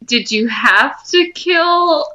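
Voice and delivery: ordinary delivery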